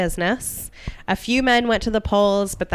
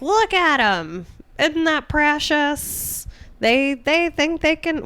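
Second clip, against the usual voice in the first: high, slow mocking men's voice; mock patronizing drawl, high and slow; attempted Oregon accent; 7 semitones higher, slower than usual. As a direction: sneer like condescending townsmen. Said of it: "what's an Oregon accent?".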